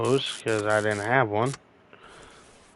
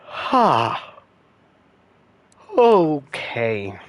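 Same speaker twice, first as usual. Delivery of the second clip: a big yawn stretching through the words, louder; 4 semitones higher, 6 dB louder, much slower than usual. Real, not acted. Yawning at the viewers while parts load. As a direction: talk right through a yawn. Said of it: "I'm yawning".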